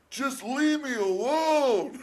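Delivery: high, loud, drawn-out mock plea; exaggerated, exasperated holler; Sylvester Stallone. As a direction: wail it like a hammed-up hunted action hero. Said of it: "like Sylvester Stallone keeps going".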